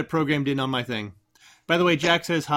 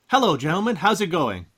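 ordinary talk

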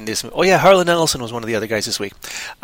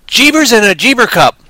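excited exclamation; 8 semitones higher, 6 dB louder than usual. Real, not acted. Exclaiming about the song.

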